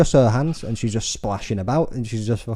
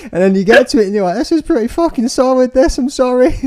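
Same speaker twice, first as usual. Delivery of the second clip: much higher and louder, laughing through it; 8 dB louder than usual. Real, not acted. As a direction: laugh while mock-apologising. Laughing, high mock apology.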